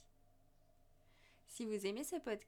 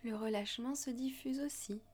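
guided meditation. Acted. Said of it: "Je vous invite à suivre ma voix lors d'une méditation".

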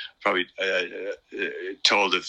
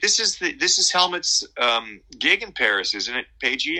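much higher, put-on [name] voice; [name] imitation; 6 semitones higher than usual. [name] chatting over a cigarette, high imitated voice. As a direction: play [name] ribbing his guitarist in a high voice. Quoting [name] backstage in Paris.

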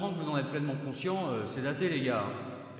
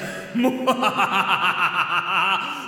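unconvincing evil laugh; 8 dB louder than usual. Acted. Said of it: "C'est pas hyper convaincant".

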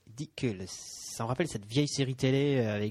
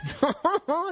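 mocking laugh; 5 dB louder than usual. Mocking the captain.

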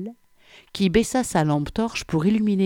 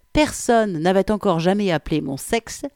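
plain reading voice

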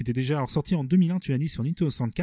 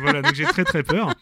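laughing through the words; high, giggly mock fear; 8 semitones higher, 5 dB louder than usual. Real, not acted. Laughing after the mic joke.

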